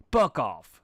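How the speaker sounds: a loud snapped scream. A narrator's reading. Gilda screaming at Pinkie before slashing her, furious. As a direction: spit it out at full volume. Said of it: "screamed Gilda".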